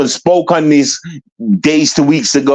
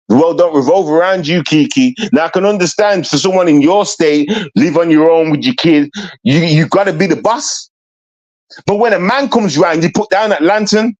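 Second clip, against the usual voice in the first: ordinary talk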